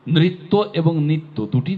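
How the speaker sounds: নৃত্য with a clear ri, নিত্য with a plain ni; contrasting pronunciation. Shown as a demonstration. Teacher contrasting নৃত্য and নিত্য for the class. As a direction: set নৃত্য against নিত্য, sounds clearly apart. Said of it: "আলাদা আলাদা উচ্চারণ".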